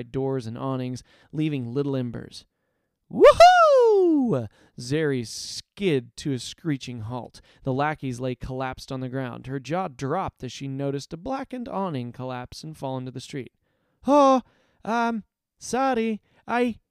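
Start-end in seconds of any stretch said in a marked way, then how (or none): 3.1-4.5 very high, loud joyful whoop. Acted. Zeri zipping with lightning. 14.1-16.8 high, flustered sorry; startled apology. Acted. Awning falls, Zeri embarrassed.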